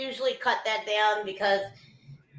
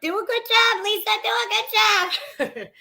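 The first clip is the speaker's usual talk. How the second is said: mock cheerleading in a high squeal; imitating brother; 10 semitones higher than usual. Host reading out her brother's message, imitated in a high voice. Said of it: "my brother's messaging me, right? He's like".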